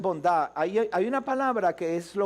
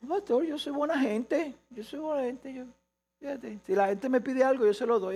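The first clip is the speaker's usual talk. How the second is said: higher, mock-pious voice boasting of goodness; self-righteous churchgoer; 5 semitones higher than usual. Congregant assuring the pastor he is kind, in a mock-pious higher voice. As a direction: play the smug believer telling the pastor how good he is.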